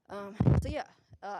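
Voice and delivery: loud breaths on the mic; breath gusting into the microphone. Real, not acted. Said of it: "I gotta stop breathing into the mic".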